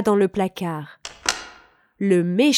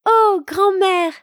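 surprised; 9 semitones higher, 5 dB louder than usual. A narrator's reading. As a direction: gasp it out in wide-eyed wonder. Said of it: "dit-elle, surprise".

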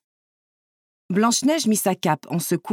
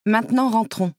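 mildly firm instruction; 3 semitones higher than usual. A narrator's reading. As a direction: take charge with understated firmness. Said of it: "dit-elle fermement".

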